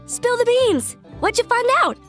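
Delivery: high, excited prodding; eager demand. Acted.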